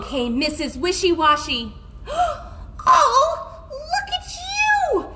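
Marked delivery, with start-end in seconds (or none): 2.1-5.1 very high, drawn-out shriek at no great volume; mock scream. A narrator's reading. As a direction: squeal it in storybook horror. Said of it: "she screamed".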